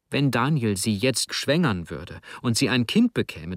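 plain reading voice

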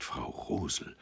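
soft, hushed address; subdued, shaken mutter; appalled murmur. A narrator's reading. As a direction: murmur it in shock. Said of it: "murmelte er".

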